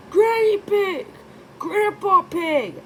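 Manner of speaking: high, loud happy cry; excited cry. A narrator's reading. Peppa greeting her grandparents, high happy cry. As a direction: cry out in delight. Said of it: "cried Peppa".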